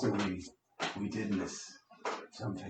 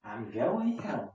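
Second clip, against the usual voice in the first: cartoon kid impression; 10 semitones higher, 5 dB quieter, slower than usual. Quoting a cartoon catchphrase.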